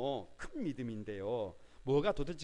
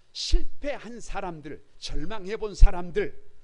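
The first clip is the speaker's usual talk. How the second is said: louder, slow, weighty phrases; emphatic slow preaching; 4 semitones higher, 14 dB louder, slower than usual. Real, not acted. Pastor stressing the people God uses, slow and loud. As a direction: land each phrase hard.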